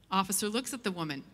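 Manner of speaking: usual speaking voice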